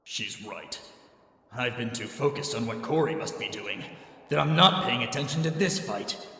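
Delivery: ordinary talk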